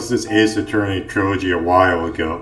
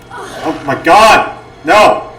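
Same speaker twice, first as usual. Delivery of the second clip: panicked high screaming; terrified horror victim; 10 semitones higher, 6 dB louder than usual. Real, not acted. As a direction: shriek in real terror. Panicked screams during horror game attack.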